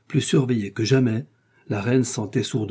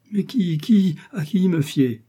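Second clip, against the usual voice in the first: high, urgent plea; anxious queen grasping for someone to trust; desperate questioning; 8 semitones higher, slower than usual. Acted. Distraught queen, nobody to trust. (questions désespérées, voix aiguë)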